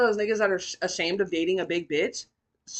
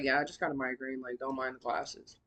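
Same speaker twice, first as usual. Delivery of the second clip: quiet excuse; 7 semitones lower, 8 dB quieter than usual. Retold or imitated.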